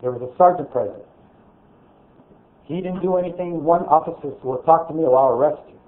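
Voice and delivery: ordinary delivery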